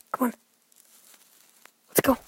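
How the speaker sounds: ordinary talk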